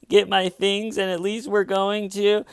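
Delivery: high, pushed fake-distraught voice; hammed-up upset, pitched well up; mock distraught. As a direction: imitate a caller performing distress. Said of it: "in the distraught tone of voice going".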